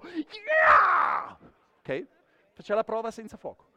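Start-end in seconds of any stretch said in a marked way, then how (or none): 0.0-1.4 loud, high bloodcurdling scream; terror scream. Shown as a demonstration. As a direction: demonstrate a chilling shriek of terror. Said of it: "devi lanciare un urlo agghiacciante di terrore in questo modo".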